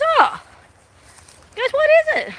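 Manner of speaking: squeaky put-on interest; imitating a man. Man asking about her old ute, high mock voice.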